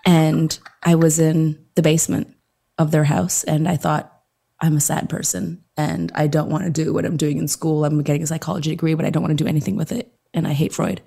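low, hushed mock-intimate narration; ASMR storytelling. Guest telling their origin story as playful ASMR, hushed. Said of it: "I'm gonna do A S M R".

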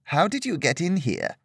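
a hint of a wary older woman asking; lightly voiced mistress, questioning; stern sword mistress.

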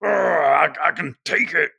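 mock macho bravado; tough guy. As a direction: play the tough fighter bracing himself. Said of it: "with an attitude like".

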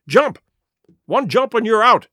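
high, loud urging; urgent call. A narrator's reading. Doctor urging Jim over the fence, loud and high. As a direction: push him to jump now.

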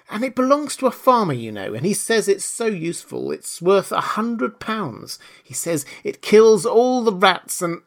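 high, eager little Alice; eager chatter. A narrator's reading. Alice tells the silent mouse about a dog, eagerly. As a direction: gush on about a favourite dog. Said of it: "so Alice went on eagerly".

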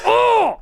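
high, loud burst of "oh!"; sudden loud yelp of protest. Real, not acted. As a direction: cry out a big high "oh!". Reacting to friend's jab.

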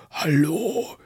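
Viking voice taunting the player. Retold or imitated. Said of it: "in so einer Wikinger-Stimme". (Wikinger-Stimme)

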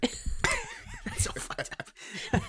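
very high and slow, cracking up; real shocked laughter; laughing. Real, not acted. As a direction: squeal it through laughter.

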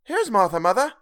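a daughter announcing herself brightly; girl's voice. A narrator's reading. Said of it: "said a girl".

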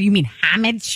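homage said HAM-ij, H sounded, rising question; retold English homage. Co-host quoting host's correction, homage as HAM-ij. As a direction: query homage with stress up front, HAM-ij. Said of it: "you said".

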